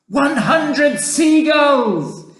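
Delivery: slow, high triumphant yell; loud, drawn-out announcement; shouting. A narrator's reading. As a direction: yell out the tally, stretched out. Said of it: "he shouted".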